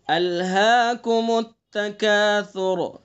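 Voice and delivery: slow melodic tilawat with long drawn vowels; slow Quran recitation. Shown as a demonstration. Teacher recites the surah for students to copy, slow melodic recitation. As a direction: chant each verse slowly, stretching the vowels. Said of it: "سا کو نرمی سے پڑھنا ہے ہمیں".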